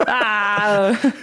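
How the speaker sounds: mock pain cry mixed with laughter; laughing ow. Real, not acted. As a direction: yelp it while still laughing. Laughing after retold injury.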